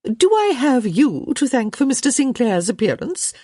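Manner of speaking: high-pitched prying society lady; angling for gossip. A narrator's reading. Hostess fishing for gossip from a guest, prying. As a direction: probe sweetly in a high hostess voice. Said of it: "clearly angling for a bit of gossip".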